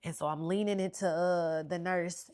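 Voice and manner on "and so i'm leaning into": normal talking voice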